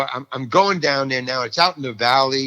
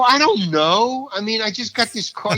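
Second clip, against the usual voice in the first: clueless protest; 9 semitones higher than usual. Retold or imitated. Actor answers director asking why he's here, clueless protest. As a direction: plead ignorance, voice jumping high.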